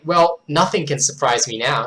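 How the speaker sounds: usual speaking voice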